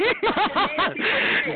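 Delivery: high-pitched taunting cackle; high laugh. Real, not acted. Taunting a rival on the phone line, high laugh. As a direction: laugh in their face, up high.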